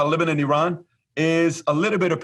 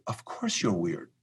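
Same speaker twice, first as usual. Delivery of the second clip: softer and slower, tender and sure; gentle reassurance; 4 semitones lower, 8 dB quieter, slower than usual. Retold or imitated. Father comforting his son called weird, soft and slow. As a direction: soothe a worried child gently.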